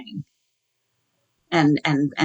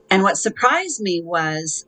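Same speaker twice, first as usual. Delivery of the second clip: usual speaking voice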